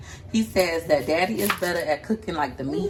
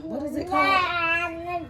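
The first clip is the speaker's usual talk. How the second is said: much higher, slow; high drawn-out musing; 11 semitones higher, slower than usual. Real, not acted. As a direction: wonder it aloud, high. Searching for a word.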